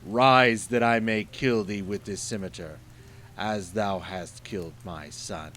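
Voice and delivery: booming, menacing monster roar; loud terrible genie, slowing at the end. Acted. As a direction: threaten him like a towering genie. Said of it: "The genie spoke to him in a terrible voice".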